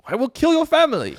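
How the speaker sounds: high caricature French threat; mock French accent. Lagarde threatening Greece, high mock French accent.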